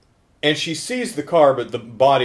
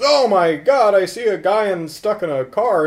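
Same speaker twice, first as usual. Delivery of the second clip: high, loud mock alarm; breaking startling news, higher and louder; alarmed kid; 8 semitones higher, 6 dB louder than usual. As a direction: cry out like an excited kid. Acts out alarmed kid telling what he saw.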